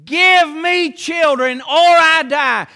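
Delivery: loud, slow, desperate cry of Rachel; shouted demand. Retold or imitated. Preacher shouting Rachel's plea to Jacob, slow and loud. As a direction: shout it out as a wife demanding children.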